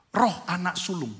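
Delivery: ordinary talk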